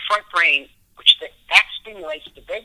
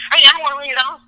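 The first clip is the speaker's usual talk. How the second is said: high and loud, hammed-up rejection; mock indignant shout; mock defiant refusal; 11 semitones higher, 8 dB louder, much faster than usual. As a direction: scoff at the offer like a stubborn fool. Refusing under stress, mocked.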